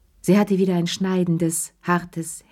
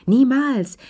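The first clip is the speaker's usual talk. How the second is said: exclaimed; 7 semitones higher, 5 dB louder than usual. Acted. Mock-offended exclamation.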